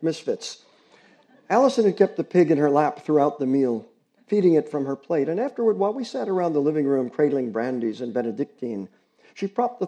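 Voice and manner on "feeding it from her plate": ordinary delivery, nothing marked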